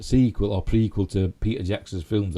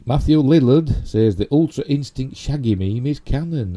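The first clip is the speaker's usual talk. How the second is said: higher, louder headline voice; headline read; 7 semitones higher, 6 dB louder than usual. Real, not acted. Host reads Shatner headline to viewers, headline read. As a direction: announce the news headline with a big lilt.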